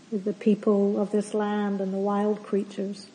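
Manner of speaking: ordinary talk, nothing marked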